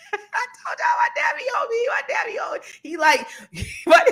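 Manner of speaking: laughing high through the words; giggling, voice cracking up high. Real, not acted. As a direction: laughs as she talks about her dad. Laughing about dad nagging.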